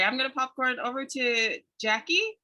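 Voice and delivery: normal talking voice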